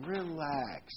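the devil luring believers, lightly mock soothing; tempting devil. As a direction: coax lazily like a tempter. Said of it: "if I were the devil, I would be saying".

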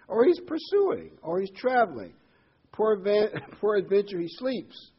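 high, loud mocking taunt; loud, hammed-up jeering. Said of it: "Elijah made fun of them".

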